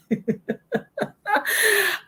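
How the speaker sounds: a bright, high laugh at her own advice; amused laugh. Real, not acted.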